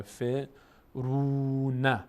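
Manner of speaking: فرون with the u stretched long. Shown as a demonstration. Teacher showing the stretched u to students.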